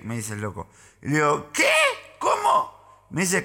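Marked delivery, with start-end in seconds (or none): 1.5-3.1 very high falsetto "what?"; comic high-pitched huh; baffled mock query. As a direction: squeak it out as if you can't hear. Acted hard-of-hearing reply.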